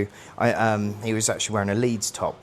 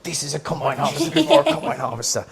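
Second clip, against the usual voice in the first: showman teacher; 5 semitones higher than usual. Retold or imitated. Teacher showing exhibit.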